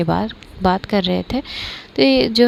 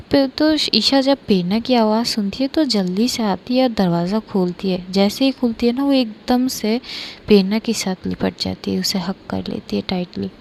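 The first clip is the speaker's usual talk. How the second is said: ordinary delivery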